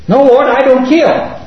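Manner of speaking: high, slow, earnest refusal; drawn-out high protest; insistent refusal. Acted. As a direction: refuse firmly with pitch lifted well up. Acted refusal to the voice.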